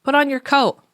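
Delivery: put-on hollering at a child to get a coat on; yelling at kid. Parent yelling across the room at a distracted child. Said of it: "you yell at your kid from across the room".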